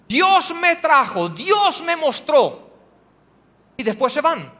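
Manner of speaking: normal talking voice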